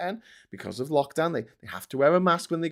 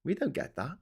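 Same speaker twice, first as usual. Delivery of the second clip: ordinary delivery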